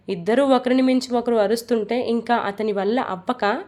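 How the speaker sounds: usual speaking voice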